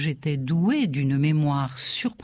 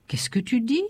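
understated, higher-pitched astonishment; stupefied exclamation; 6 semitones higher than usual. A narrator's reading. Stupefied father turning to his son, mildly exclaiming. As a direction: ask it higher, taken aback. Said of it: "me regarda stupéfait et s'écria".

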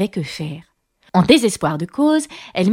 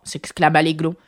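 ordinary delivery, nothing marked

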